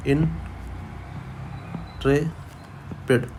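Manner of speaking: Intrepid split into slow separate syllables, almost whispered; syllable-by-syllable breakdown of Intrepid; broken into pieces. Shown as a demonstration. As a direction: break Intrepid apart, in-tre-pid, very slowly. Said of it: "इसको टुकड़े कर दो".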